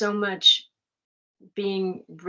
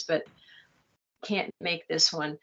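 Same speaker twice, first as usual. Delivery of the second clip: ordinary delivery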